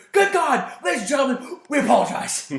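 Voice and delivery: high, loud mock commentator outburst. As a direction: imitate an aghast wrestling announcer. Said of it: "then J R does his pennant".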